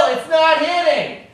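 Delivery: high, loud, slow mock coaching; high-pitched heckle at the sad guy; heckling Phil. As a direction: egg Phil on like a pushy coach. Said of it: "I'm like, Phil".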